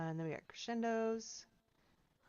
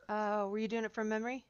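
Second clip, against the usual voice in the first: ordinary talk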